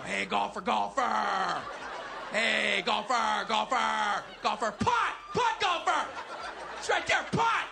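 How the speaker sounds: loud chant rising to high yell; heckling chatter. Acted. Heckler chatters at a golfer putting, loud heckling. Chatter moved to golf course.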